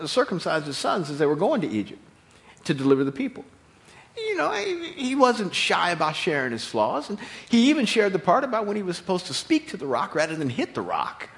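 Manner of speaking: usual speaking voice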